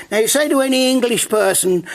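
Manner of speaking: usual speaking voice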